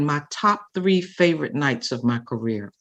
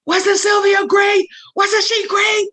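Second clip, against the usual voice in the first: calling to crowd; 4 dB louder, slower than usual. Retold or imitated. Whitney presents her to crowd.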